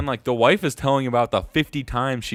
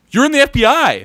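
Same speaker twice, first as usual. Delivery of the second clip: incredulous; 12 semitones higher, 9 dB louder than usual. Retold or imitated. Disbelief at FBI agent.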